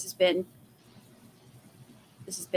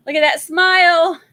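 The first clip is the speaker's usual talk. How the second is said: excited; 9 semitones higher, 11 dB louder than usual. Real, not acted. Gushing over a viewer's picture.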